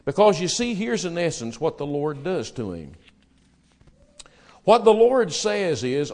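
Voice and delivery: normal talking voice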